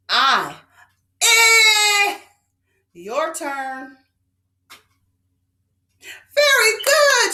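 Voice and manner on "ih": IH held long, high and loud, as in sit; stretched short I. Shown as a demonstration. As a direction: lead the class in a long high IH. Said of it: "Stretching that letter I".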